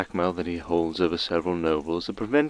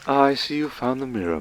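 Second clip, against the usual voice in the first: usual speaking voice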